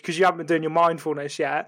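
ordinary delivery

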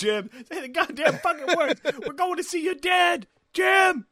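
high, loud hammed-up pleading; frantic mock plea. Mocking the driver begging Jim, high hammed-up plea. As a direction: overact the desperate driver.

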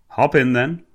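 light Cockney cab driver; cheery Cockney accent, lightly done. A narrator's reading. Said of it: "thick Cockney accent".

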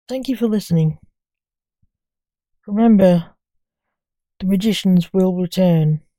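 loud, showy host sign-off; podcast announcer. Acted.

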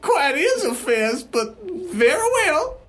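put-on high, quavery old lady; elderly wife, squeaky and mannered; Sherry Ovaltine. Old lady insisting to the bellhop, high granny voice. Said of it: "Should I do a voice?".

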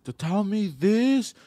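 high, very slow indignant cry; retold screaming. Kid challenging him in a retold fight, mock screaming. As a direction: drag it out in mock outrage. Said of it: "And I starts screaming".

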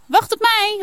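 high eager call after her friend; a girl calling wait for me, high and loud. A narrator's reading. Janna calls after Saar, high eager call. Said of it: "roept Janna".

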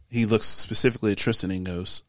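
normal talking voice

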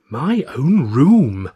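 loud, drawn-out amazed delight; exclaiming. A narrator's reading. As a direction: savour each word in wonder, high and slow. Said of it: "Rayner exclaimed".

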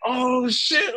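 high, hyped-up yelp of disbelief; an excited teen freaking out; excited kid. Retold or imitated. A kid in the van freaks out, excited and high. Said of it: "like, going crazy in the van".

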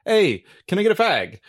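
chirpy British stranger asking for a cigarette; mock British ask. Stranger in England asking for a cigarette, mock British voice. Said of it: "someone was like".

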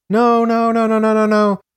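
high, loud unbroken run of no's; acted screaming. A narrator's reading. Narrator voices victim's screaming, acted high cries. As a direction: cry out fast-repeated protests high and loud.

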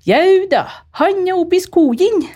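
high, loud Tresfjord dialect reply; father-in-law telling her where Frode is, in Tresfjord dialect. Retold or imitated. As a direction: answer cheerily in broad Tresfjord dialect. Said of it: "da sa svigerfar".

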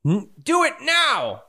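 high, drawn-out urgent command; urgent character voice. Acted. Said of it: "you hear".

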